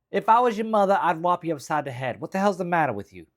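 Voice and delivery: loud, high Jersey scolding; Jersey accent. Acted. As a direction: tell them off as an outraged Jersey woman. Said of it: "like, the accent or whatever".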